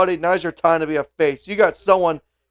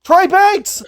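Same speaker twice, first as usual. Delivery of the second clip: much higher, louder surprised call; surprised commentator; 12 semitones higher, 5 dB louder than usual. Real, not acted. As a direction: react to the surprise arrival. Surprise run-in during the match.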